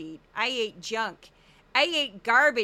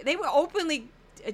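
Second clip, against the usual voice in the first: usual speaking voice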